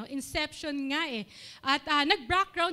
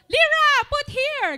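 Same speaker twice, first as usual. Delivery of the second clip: commanding shout; 12 semitones higher, 11 dB louder, slower than usual. Retold or imitated. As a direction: imitate a niece's bossy, high, loud yell. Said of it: "para siyang sumisigaw".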